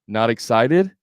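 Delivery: "excited" rising clearly at the end; question-mark inflection. Shown as a demonstration. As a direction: turn "Not excited" into a question by tune alone. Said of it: "It's the question mark".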